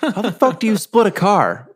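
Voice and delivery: outraged shout. Retold or imitated. Kidnapper yelling at Jerry over splitting the car, outraged. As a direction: blow up in disbelief over the deal. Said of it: "He's like".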